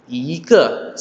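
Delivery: Ike with long rising first syllable; second tone on i. Shown as a demonstration. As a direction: lift I before a falling ke. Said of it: "nada kedua".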